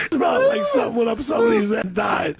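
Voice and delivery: high-pitched mock complaint; hammed-up cry of disgust; imitated disgusted outcry. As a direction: act out the appalled passenger, voice way up. Retelling bus rider's disgusted complaint.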